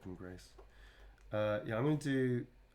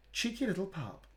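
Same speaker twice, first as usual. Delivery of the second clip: affectionate tease; 8 semitones higher than usual. Real, not acted.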